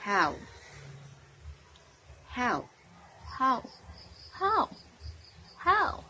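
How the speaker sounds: How? repeated, climbing to a high squeak; rising How. Shown as a demonstration. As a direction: push How? up the scale each time. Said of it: "repeating how with rising pitch".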